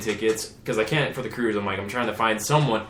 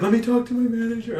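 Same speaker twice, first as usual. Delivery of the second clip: mock teary clerk voice; 12 semitones higher, 5 dB louder than usual. Imitating crying airline lady.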